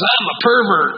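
high-pitched quoted declaration; retold blurted confession. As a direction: announce it as the visiting speaker to an awkward room. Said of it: "Then he says".

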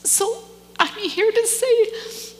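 slow, high, tear-choked; fighting back tears; holding back tears before the church; tearful. Real, not acted. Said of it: "I'm crying".

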